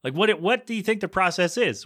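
ordinary talk

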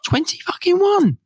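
loud, high burst of disbelief; excited shout. Real, not acted. Podcaster celebrating reaching twenty-one in the chart, excited shout. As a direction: shout it, voice leaping right up.

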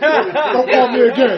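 loud, drawn-out mock rejection; mock angry rebuff. Acts out annoyed friend being called.

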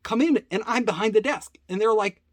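normal talking voice

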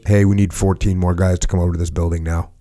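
low, even, controlled radio call; steady calm under pressure. Shown as a demonstration. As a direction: keep your voice in check on the radio. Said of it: "I just put on the calm voice".

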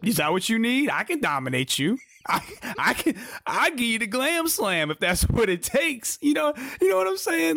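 playful wrestling promo impression; mock wrestler promo. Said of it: "That's her promo".

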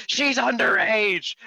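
high, slow, loud shriek; shocked shriek. Retold or imitated. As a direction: shriek it out in mock outrage. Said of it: "and it's like".

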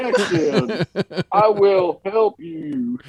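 slow Texas drawl; Texan drawl. Acted. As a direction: stretch it out like a Texan. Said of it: "Texan who actually has a drawl".

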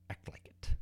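hushed, whispered command; whispered movie line. Retold or imitated. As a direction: whisper the coach's final line for effect. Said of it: "a quote from one of my favorite movies".

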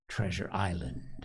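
hushed, breathy title whisper; a soft dramatic whisper of the title. A narrator's reading. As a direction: whisper it like a secret treasure. Narrator whispers the book title.

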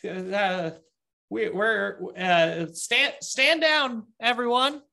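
high, nervous and placating. Acted. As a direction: squeak it out anxiously to calm them. Nervous guard calling to friends above.

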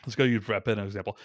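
normal talking voice

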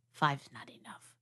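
unvoiced whisper aside; hushed stage whisper. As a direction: breathe it secretly.

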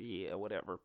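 quiet, throwaway shrug; mock dismissive Pfizer. Pfizer brushing off a rival's result, quiet dismissal. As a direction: brush it off softly. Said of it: "Pfizer's like".

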